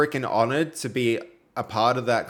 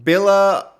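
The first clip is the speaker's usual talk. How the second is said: ordinary talk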